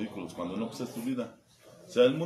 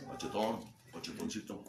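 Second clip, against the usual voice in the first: cooing nickname; 5 semitones lower, 9 dB quieter than usual. Retold or imitated. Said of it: "le estaba hablando".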